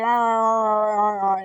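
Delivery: long imitated groaning grunts; grunting. Lurch grunts his whole backstory, drawn-out grunting. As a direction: groan wordlessly as if telling a story. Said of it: "he grunts his whole backstory".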